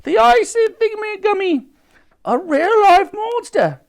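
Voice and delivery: very high, loud policemen in alarm; shrieking officers fleeing a monster; panicked cries. A narrator's reading. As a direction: cry out in hammed-up panic. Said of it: "The officers all cried out".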